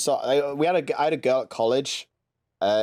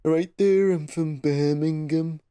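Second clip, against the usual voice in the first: slow, higher, droning mock Birmingham accent; depressing Brummie accent; 5 semitones higher, slower than usual. Shown as a demonstration. Mimicking the boring Brummie accent for a friend, dreary Brummie. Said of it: "it's so depressing".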